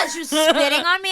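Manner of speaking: normal talking voice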